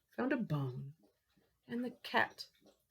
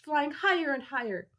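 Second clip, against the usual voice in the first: usual speaking voice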